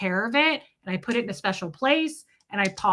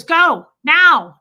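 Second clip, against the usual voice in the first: loud, high barked command; sharp order; 6 semitones higher, 13 dB louder than usual. Retold or imitated. Friend pushing her to inhale, loud order. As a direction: snap it out like a bossy friend. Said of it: "she goes".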